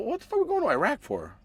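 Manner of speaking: ordinary talk, nothing marked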